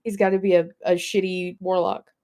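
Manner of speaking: ordinary talk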